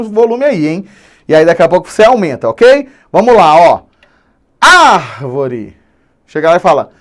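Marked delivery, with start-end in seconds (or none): 4.6-5.8 drawn-out call with ÁR stretched; calling at the gate. Shown as a demonstration. Teacher demonstrating the gate trick, calling out. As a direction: call out "árvore", holding the first syllable. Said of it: "O famoso grita no portão".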